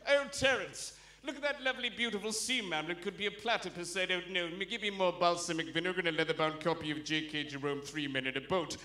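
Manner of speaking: low, leisurely chatter of a well-off yachtsman; Southern yachtsman. Acted. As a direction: play the southern bloke on his yacht. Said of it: "there'll be a bloke on his yacht going".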